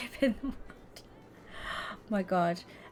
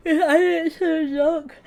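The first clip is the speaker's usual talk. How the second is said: high, loud, slow words through laughter; real laughter taking over the sentence; can't stop laughing; 7 semitones higher, 6 dB louder, slower than usual. Real, not acted. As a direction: squeal it while losing it. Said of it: "I can't stop laughing".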